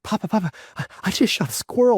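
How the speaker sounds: put-on high, breathless kid blurting the news; excited kid. Son rushing to tell his papa, excited high voice.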